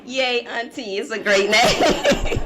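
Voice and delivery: high, drawn-out cheer breaking into laughter; laughing mock cheer. Niece applauds auntie's choice of name, laughing mock cheer.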